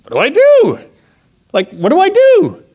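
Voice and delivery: mock panic. Driver left alone by the officer, mock-panicked. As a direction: wail the question in comic panic.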